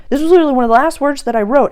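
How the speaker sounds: excited burst. Real, not acted. Excited recall of last words.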